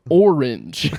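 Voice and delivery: loud, over-enunciated language-tape word; mock instructional-tape announcer; English lesson voice. Shown as a demonstration. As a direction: present it like a vocabulary example. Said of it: "You sound like every example voice in our English lessons".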